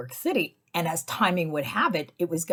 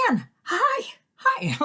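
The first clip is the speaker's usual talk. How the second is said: high squealing fangirl hello; starstruck squeal; 4 dB louder, much slower than usual. Retold or imitated. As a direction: gush like meeting your idol. Greeting a TV host, starstruck.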